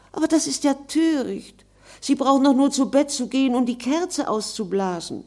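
put-on bright, high girl's voice, quick and pert; a spirited girl talking back; lively young girl. Virginia answers the ghost. (helles, lebhaftes junges Mädchen)

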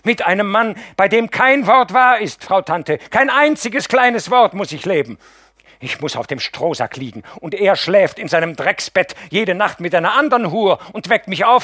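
high, loud, raging outburst ending in a shout; bursting out. Acted. Mascha explodes at her husband in front of the aunt, raging shout. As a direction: let it all explode at once. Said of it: "bricht los".

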